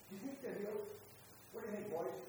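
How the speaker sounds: ordinary talk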